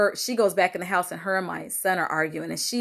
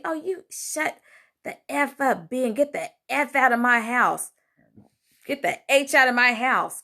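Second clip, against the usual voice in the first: retold cussing out; 5 semitones higher than usual. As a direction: throw her out, mock furious. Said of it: "just started cussing me".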